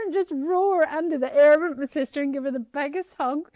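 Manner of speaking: high, loud and laughing, words bursting out; laughing excited retelling. Real, not acted. Guest telling host about leaving court, laughing and excited. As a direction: relive the joy, laughing through it.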